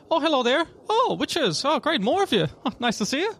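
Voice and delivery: high, cheery elf shopkeeper; elf woman. Acted. Elf alchemist greets witchers at her shop, high and cheery. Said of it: "elf woman come out".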